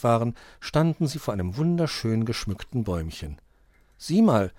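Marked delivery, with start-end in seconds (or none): 4.0-4.5 higher, louder delighted discovery; beaming. A narrator's reading. Dominik shows his companion the fox's den, beaming. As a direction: point it out with delight. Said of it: "strahlte Dominik".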